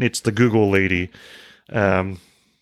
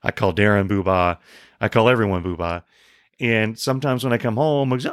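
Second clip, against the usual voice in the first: ordinary talk, nothing marked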